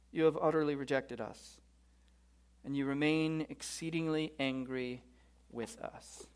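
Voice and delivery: grave reading, sinking softly at the end; sombre, fading lament; solemn scripture reading. Acted. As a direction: let the bleak final verse die away. Reads the bleak last verse.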